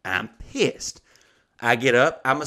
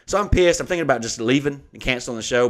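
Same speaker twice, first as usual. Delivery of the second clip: usual speaking voice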